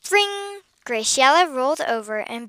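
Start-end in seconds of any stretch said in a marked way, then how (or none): 0.1-0.6 high, sustained "ring"; ringing sound. A narrator's reading. Alarm waking the sleeping girl, high ringing. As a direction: imitate an alarm going off.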